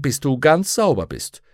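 insist, brooking no argument; stern. A narrator's reading. Mrs Lonsdale ordering Lyra to get clean, stern. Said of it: "sagte sie streng".

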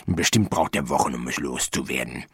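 quiet, low self-talk; muttering. A narrator's reading. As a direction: mutter on to yourself. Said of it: "murmelte er".

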